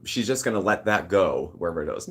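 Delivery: usual speaking voice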